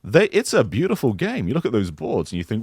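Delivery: ordinary delivery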